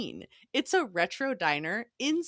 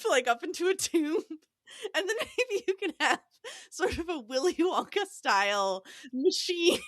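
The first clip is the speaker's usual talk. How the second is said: ordinary delivery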